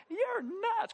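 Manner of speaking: high, laughing disbelief; laughing retort. Retold or imitated. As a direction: toss it off through a laugh. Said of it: "I'm like".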